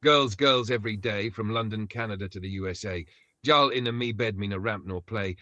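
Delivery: Jamaican patois toasting; Yardie style. Pretend. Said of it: "Let me drop it proper fire, Yardie style".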